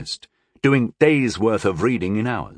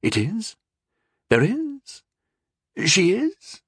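rising, baffled questions; confused; 9 semitones higher, slower than usual. A narrator's reading. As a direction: ask in bewildered confusion.

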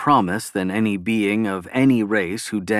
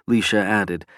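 ordinary delivery, nothing marked

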